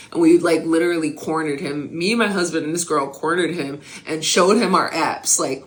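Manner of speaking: usual speaking voice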